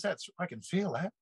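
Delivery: high, rising voice of retold disbelief; lightly acted astonishment; retold wonder. Friend's amazed reaction, acted in retelling.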